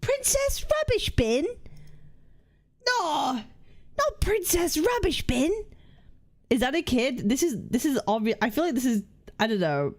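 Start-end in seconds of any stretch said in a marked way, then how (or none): 0.0-1.4 high-pitched mock voice. Reading a silly name aloud. 2.9-5.5 high put-on voice. Correcting in a put-on voice.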